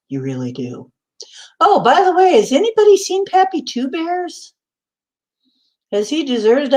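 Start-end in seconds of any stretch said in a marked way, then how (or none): 0.1-0.9 soft whisper just for Barry; whispered aside. Real, not acted. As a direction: breathe it as a private aside. Aside to Barry after compliment.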